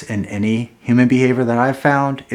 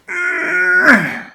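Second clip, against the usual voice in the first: high gasp; 4 dB louder than usual. Acted. Reacts after the threat skit.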